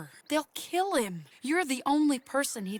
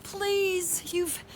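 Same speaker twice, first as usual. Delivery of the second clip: high, carrying call across distance; 10 semitones higher, slower than usual. Acted. Mary calling out to her brother Jamie. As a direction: call him home from afar. Mother calls son home.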